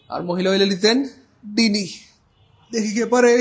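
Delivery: loud, eager challenge to the class; rally the students to answer. Real, not acted. Teacher loudly daring students to solve the question.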